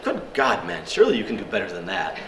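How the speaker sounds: high, exclaiming mock English gentleman; English accent. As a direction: chide him in a hammed-up English accent. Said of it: "they're all English over there".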